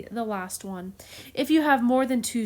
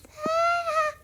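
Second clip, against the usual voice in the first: very high, louder squeaky cooing; cutesy baby talk; 4 dB louder than usual. Pretend. Teacher shows a cute little neume, cutesy baby talk. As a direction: coo over it as over a tiny baby.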